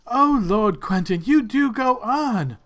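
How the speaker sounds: high, slow, drawn-out lady's chiding; noble lady. Acted. Lady beside Lord Quentin teases him, high slow noble lady voice. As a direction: play a lady indulgently teasing her lord. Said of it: "the lady next to him says".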